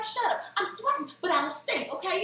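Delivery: high-pitched, mock-defensive comeback; retold squeal of self-defense; defensive retort. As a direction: protest it up high, playfully indignant.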